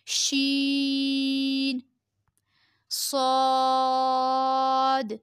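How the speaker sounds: one long level held vowel; drawn-out letter. Shown as a demonstration. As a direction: stretch the letter name slowly. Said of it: "پانچ انگلیوں کے برابر کھینچیں گے".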